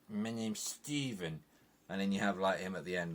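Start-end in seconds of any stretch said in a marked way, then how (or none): 0.1-1.4 slow, drawn-out imitation of Steven's English accent; a lighter take on the same silly English accent; mock Steven accent. Reviewer mocking Steven's line again, lightly imitated accent. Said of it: "him going".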